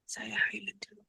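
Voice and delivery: soft unvoiced murmur to herself; whispered aside. Real, not acted. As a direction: whisper it under your breath. Aside while sharing screen. (berbisik sendiri)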